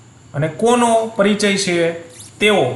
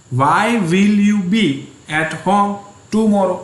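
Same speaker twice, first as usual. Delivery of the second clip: slow dictation; 2 semitones higher, much slower than usual. Shown as a demonstration. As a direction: read it out slowly for the class to write. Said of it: "teacher repeats dictation".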